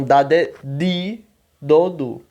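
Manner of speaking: "di" with a pure D, no J sound; slow drill, "di" never turning into "dji"; northeastern hard D. Shown as a demonstration. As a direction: recite "dá de, di, do, du" with hard northeastern Brazilian D. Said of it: "do português do nordestino".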